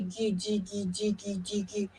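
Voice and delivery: quiet run of "gi", each placed forward in the mouth; forward syllable. Shown as a demonstration. Oboist drilling the syllable for students, soft forward "gi". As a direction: repeat "gi" softly and evenly, lips forward. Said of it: "keeps it quite forward in your mouth".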